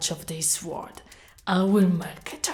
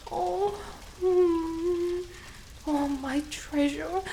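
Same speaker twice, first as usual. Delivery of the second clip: normal talking voice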